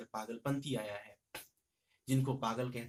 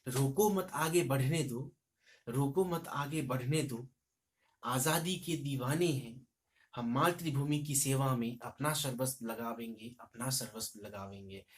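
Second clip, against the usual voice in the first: patriotic poem recitation; 4 dB louder than usual. Shown as a demonstration. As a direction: proclaim it like a freedom song. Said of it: "अगली line हम प्रस्तुत, प्रस्तुत करते हैं".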